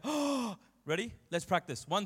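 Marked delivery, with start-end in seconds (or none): 0.0-0.6 gasp, then a high startled oh; mock shocked gasp. Shown as a demonstration. Pastor demos the gasp he wants if the pulpit falls, hammed-up shock. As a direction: show the crowd how to gasp in shock. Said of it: "I want you all to go".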